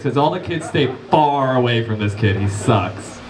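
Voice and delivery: ordinary talk, nothing marked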